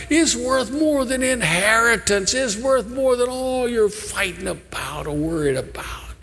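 building sermon plea; rising preaching. Acted. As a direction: lift the voice and press the point.

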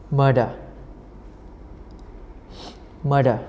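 "Murder" said alone with a clear d; d-sound demonstration. Shown as a demonstration. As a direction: model the middle d of "Murder" for students. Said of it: "âm đờ nè".

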